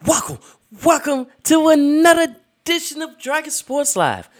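big high booming welcome; hype announcer. Acted. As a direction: belt it like a ringmaster. Hyped show intro.

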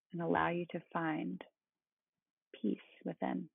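soothing, unhurried meditation guide; calm meditative tone. Acted. Leads a guided body-scan meditation.

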